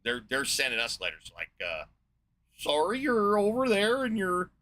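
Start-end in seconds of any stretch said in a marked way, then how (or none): 2.6-4.5 higher, louder, slow mock Canadian accent, ending on eh. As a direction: put on a jokey Canadian voice, pitched up and drawn out. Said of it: "Or that's Canadian, isn't it?".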